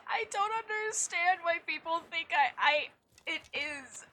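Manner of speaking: high, baffled protest; can't believe what chat says; incredulous. Real, not acted.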